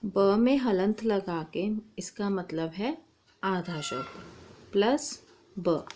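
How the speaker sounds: ordinary talk, nothing marked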